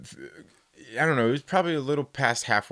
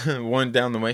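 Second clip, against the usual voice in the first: ordinary talk, nothing marked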